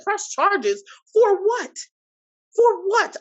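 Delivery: high, loud and stretched, disbelieving; outraged shout. Retold or imitated. Accused shopper yells at the cop over pressing charges, outraged shout. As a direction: shout back at the threat of charges. Furious retort to cop's threat.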